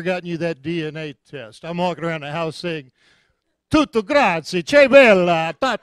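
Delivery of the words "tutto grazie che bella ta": loud, hammed-up fake Italian phrases; showing off fake Italian at home; mock Italian. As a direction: swagger through made-up Italian. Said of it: "making stuff up".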